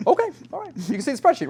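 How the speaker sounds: high-pitched, loud retold acceptance; imitated client. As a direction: mimic a client awkwardly carrying on with business. Client resumes business, retold.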